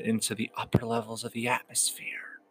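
whispered. Acted. Meditation imagery of evaporating worries.

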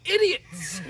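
high, loud scolding voice; mock moderator. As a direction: shout it down at them as the judge. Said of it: "He's like".

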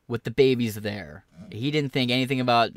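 usual speaking voice

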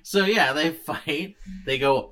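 usual speaking voice

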